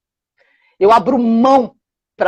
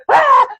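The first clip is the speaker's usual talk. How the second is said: imitated bellow; 14 semitones higher than usual. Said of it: "Ele veio berrando".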